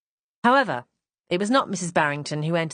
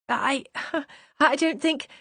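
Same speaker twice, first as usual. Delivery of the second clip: nervous laugh; 8 semitones higher, slower than usual. Acted. Flustered laugh before refusing.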